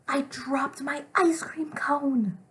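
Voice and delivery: high, loud, drawn-out wail of protest; acted upset with strong feeling; upset exclamation. Shown as a demonstration. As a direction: pretend outrage, stretching every word. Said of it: "It is an upset exclamation".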